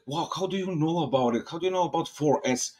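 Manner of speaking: high-pitched, incredulous reaction; astonished. Retold or imitated. Reacting to French friends' unexpected question, astonished.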